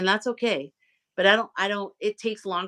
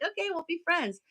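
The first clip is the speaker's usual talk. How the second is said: sweet eager yes; 11 semitones higher, 6 dB quieter than usual. Retold or imitated. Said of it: "I'm like".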